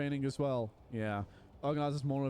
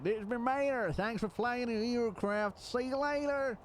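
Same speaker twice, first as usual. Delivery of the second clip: squeaky mascot outro; Meta's voice; 9 semitones higher than usual. Retold or imitated. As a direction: sign off like a goofy mascot. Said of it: "end it and be like".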